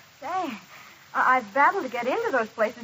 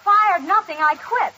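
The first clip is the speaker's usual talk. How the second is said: higher, indignant parting shot; defiant anger; 4 semitones higher, 4 dB louder than usual. Acted. Fired waitress quitting on the spot, defiantly. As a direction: storm out in a raised, angry voice. Quits after being fired.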